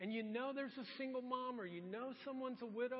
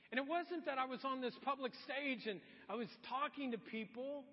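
ordinary talk, nothing marked

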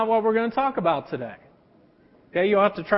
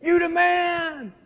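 high, excited crowd voice; high mock fan cheer; 7 semitones higher, much slower than usual. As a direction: hype him up like a fan. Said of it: "People are like".

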